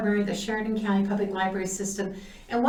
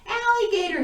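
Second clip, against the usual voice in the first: much higher, louder cry of a proud dad; excited dad; 10 semitones higher, 3 dB louder than usual. Acted. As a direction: announce it like a proud adventurer.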